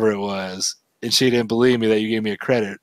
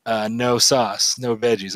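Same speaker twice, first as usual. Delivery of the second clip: broken English; slower than usual. Retold or imitated. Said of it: "really bad broken English".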